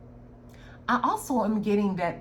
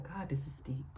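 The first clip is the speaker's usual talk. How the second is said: hushed awe; 5 semitones lower, 10 dB quieter than usual. Real, not acted. Awed aside during reading.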